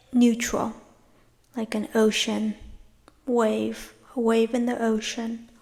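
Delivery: unhurried meditation guide, leaving space after each phrase; guided meditation. Real, not acted. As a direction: lead them gently inward, phrase by phrase.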